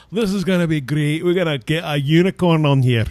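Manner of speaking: put-on a loud, excited Scottish accent. Podcast host retelling Scots voting for the unicorn, in a Scottish accent. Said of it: "that Scottish accent".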